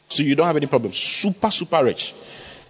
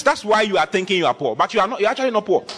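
usual speaking voice

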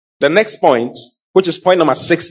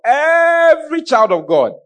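"every" stretched out long and high; stress on every; 12 semitones higher, slower than usual. Real, not acted. Preacher telling congregation it's for all, drawn-out high "every". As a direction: hold "every" on a raised pitch, then fall.

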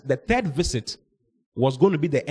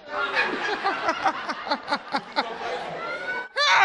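high laugh; 3 dB quieter than usual. Real, not acted. Laughing at the story.

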